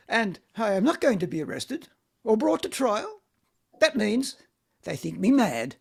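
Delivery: ordinary talk, nothing marked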